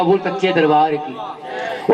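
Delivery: low, drawn-out refrain; devotional refrain. Real, not acted. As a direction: drop low and stretch the call to the devotees. Preacher's refrain cueing the congregation.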